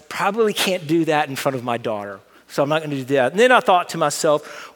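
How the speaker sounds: ordinary talk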